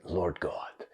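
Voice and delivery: slow, soft prayed whisper; whispered prayer. Retold or imitated. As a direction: pray it slowly under your breath. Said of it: "her closing prayer".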